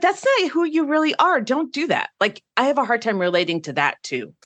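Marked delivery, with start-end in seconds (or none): 0.0-2.1 high, exasperated retort to a friend who copies her; mock exasperated pushback. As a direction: scold them for not being themselves, higher. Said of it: "And then I'm like".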